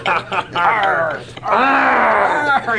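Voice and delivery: loud, high pirate yell; shouted pirate arr. Acted. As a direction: bellow it like a pirate.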